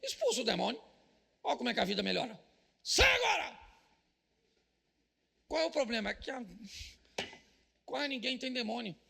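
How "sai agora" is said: high, loud "Sai agora!" shout; exorcism command. Acted. Pastor playing an exorcist casting out a demon, shouting. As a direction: cast the demon out at full force.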